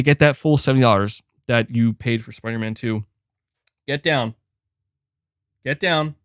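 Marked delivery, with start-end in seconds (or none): none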